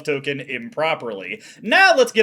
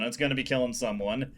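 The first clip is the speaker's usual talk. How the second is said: lower, softer sinister repeat; low quiet take; 5 semitones lower, 9 dB quieter, faster than usual. Shown as a demonstration. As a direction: murmur it darkly.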